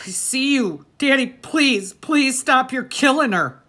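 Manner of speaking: high, loud begging cry; pleading scream. A narrator's reading. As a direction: beg him to stop at the top of your voice. Boy begs father to stop.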